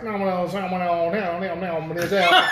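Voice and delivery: rapid twangy Missouri mumble of no's; mock a Missouri drawl that sounds like a banjo plucking; playful Missouri banjo-accent imitation. Shown as a demonstration. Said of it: "it just sounds like banjo".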